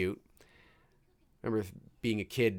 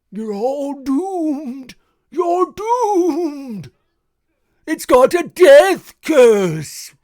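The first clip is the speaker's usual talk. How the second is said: high, loud, slow wailing doom warning; slow ominous Crazy Ralph drawl; 13 dB louder, much slower than usual. Retold or imitated. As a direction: drag out the doom like a mad prophet. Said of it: "oh, there's Crazy Ralph".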